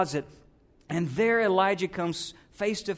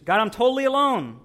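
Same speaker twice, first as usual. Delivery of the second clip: higher, louder cry of self-pity; despairing complaint; 4 semitones higher, 7 dB louder than usual. Acted. Elijah in a bad place, complaining to God in despair. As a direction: complain loudly to God as if abandoned.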